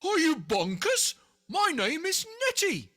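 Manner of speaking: high, loud indignant cry; outraged retort; indignant monster. A narrator's reading. Nettie tells George off for the mistake, loud and indignant. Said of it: "with a horrible howl".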